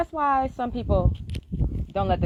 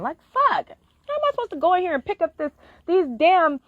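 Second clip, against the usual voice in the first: higher and louder, overwhelmed and near tears; frustrated teary outburst; 9 semitones higher, 5 dB louder than usual. Retold or imitated. Crying in her car before a pickup, higher and louder, frustrated.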